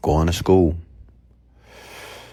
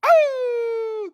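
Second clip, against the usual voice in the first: long, high imitated dog howl; high-pitched hound's howl, drawn out. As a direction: howl like a dog, sliding slowly down. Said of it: "the dog is howling and crying again".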